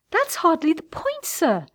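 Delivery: trembling, high indignation; barely suppressed anger. A narrator's reading. Woman rebuking her suitor, barely suppressed anger. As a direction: hold the anger in, pitch riding high. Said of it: "voice trembling with barely suppressed anger".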